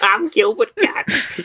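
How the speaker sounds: a second, mocking stab at the voice; Gilbert Gottfried impression. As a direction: drag it out high and squawky. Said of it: "I wanna hear your Gilbert Gottfried voice".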